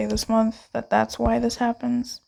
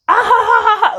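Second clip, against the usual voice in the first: higher, louder hammed-up guffaw; mock laughter; 11 semitones higher, 8 dB louder, faster than usual. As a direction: act out a crowd cracking up. People laughing at the joke.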